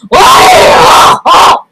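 mock high-pitched, breathy "woo" cry; imitated reaction cry. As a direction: act out your own startled whoop. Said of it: "I was like".

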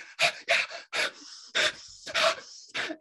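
long breathy mock sigh of exhaustion; exhausted sigh. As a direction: sigh it out like you're wiped out. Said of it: "I'm like".

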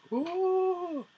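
very high, sustained "ooh"; mock pained howl. Reviewer mimicking the man stabbed in the leg, high mock howl. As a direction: imitate a cartoonish high yelp, held long.

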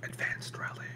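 hushed unvoiced whisper. Real, not acted. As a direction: breathe the name in a whisper. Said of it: "Why are you whispering?".

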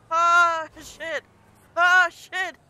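loud curse. Real, not acted. Crash while driving in game.

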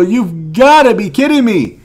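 loud, high outburst of disbelief; real frustration boiling over; loud furious disbelief at another single card; exasperated yell. Real, not acted. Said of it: "I'm about to lose my mind".

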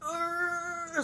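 high strained grunt; imitated toilet grunt. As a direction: push out a silly high strain noise. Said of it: "you're gonna make the sound".

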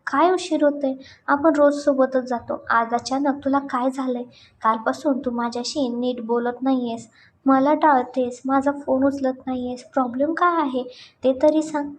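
plain reading voice

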